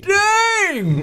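high shouted cowboy yell. Acted. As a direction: whoop it like a cowboy. Mock cowboy exclamation.

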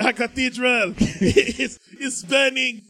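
slow, laughing French wail; hammed-up French lament, lightly done; mock French lament. Mock French lament over Notre Dame.

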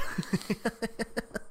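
soft high giggle after the impression; high breathy laugh. Real, not acted. Co-host laughing at her own Doolittle impression, soft giggle. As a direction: laugh quietly at your own joke.

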